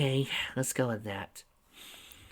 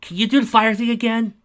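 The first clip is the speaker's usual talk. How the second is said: higher, louder question; loud high call; 10 semitones higher, 7 dB louder than usual. Acted. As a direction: call across the cart. Sarah calls out to Charlene.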